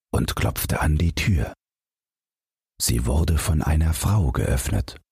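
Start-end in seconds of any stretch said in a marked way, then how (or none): none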